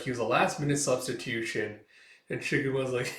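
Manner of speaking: ordinary talk, nothing marked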